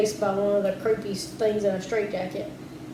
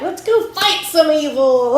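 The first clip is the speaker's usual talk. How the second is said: eager hero voice; 5 semitones higher, 7 dB louder, slower than usual. Retold or imitated. Spike's eager line retold.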